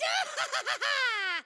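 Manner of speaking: hammed-up cackle of triumph; gloating villain laugh. Grunt has just slain a Spartan, gloating laugh. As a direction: cackle over the fallen enemy.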